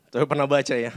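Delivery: usual speaking voice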